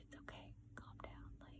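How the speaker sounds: whispered imitation of mom soothing; barely audible, whisper it to settle someone; hushed reassurance. Mom hushing her emotional daughter, soothing whisper.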